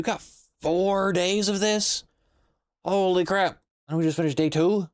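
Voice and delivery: high, incredulous squeak; can't believe how long this is; shocked disbelief. Real, not acted. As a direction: pitch jumps way up in disbelief. Shocked at game length.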